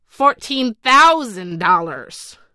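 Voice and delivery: put-on loud, slow sour old woman's cry; a sharp, loud, drawn-out bid. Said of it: "cried a sour-looking woman".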